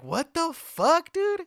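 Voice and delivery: high, drawn-out what the fuck; retold disbelief. Friends hear the drunk guy is back at the party, disbelieving.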